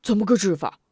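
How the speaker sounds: eager question. A narrator's reading. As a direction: press for the answer. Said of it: "孟浣熊急切问道". (急切地问)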